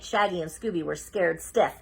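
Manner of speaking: ordinary delivery, nothing marked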